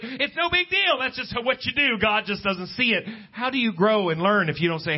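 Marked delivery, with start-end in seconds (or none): 0.0-3.0 pitched high, breezy and dismissive; preacher mocking an easy excuse; mocking shrug. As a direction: parody the careless attitude. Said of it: "it's almost like".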